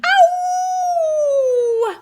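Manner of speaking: very high, loud dog howl; excited howl. A narrator's reading. The dog Onyx howling as the boat leaps into the air, a high joyful howl. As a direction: howl with joy. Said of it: "Onyx howled in exhilaration".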